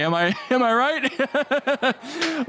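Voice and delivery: slow, laughing through the question. Real, not acted. Comic asking the crowd while laughing. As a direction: chuckle the words out slowly.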